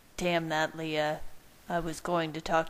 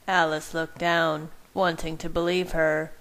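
plain reading voice

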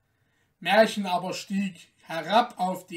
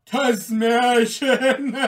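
slow, loud, drawn-out mockery; scornful cry; 5 semitones higher, 9 dB louder, much slower than usual. Guards jeering at Märchen, loud and slow. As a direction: sneer the name out. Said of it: "riefen die Wächter und lachten".

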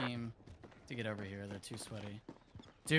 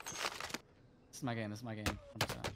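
whispering to himself; 3 semitones lower, 7 dB quieter than usual. Real, not acted. Focused mid-fight, muttering to himself.

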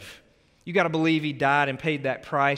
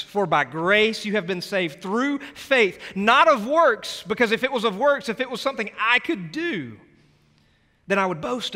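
preaching at full force; 7 semitones higher, 5 dB louder than usual. Acted. A pastor preaching grace to his church at full force. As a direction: lift the congregation, ring it out.

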